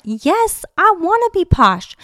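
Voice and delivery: loud, high fan declaration; emphatic excitement. Real, not acted. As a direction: shout it proudly, high and loud. Mock outburst about being [name].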